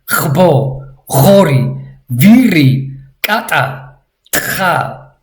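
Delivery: usual speaking voice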